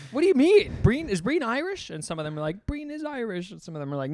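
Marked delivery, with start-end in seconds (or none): 0.1-1.9 high-pitched, loud mock bewilderment; shaken disbelief. Comic retelling his identity crisis before Irish teens, bewildered. 2.7-3.5 high-pitched mock teenage voice; teen chiming in. Comic imitating an Irish teen backing him up, high-pitched. Voicing some of the teens.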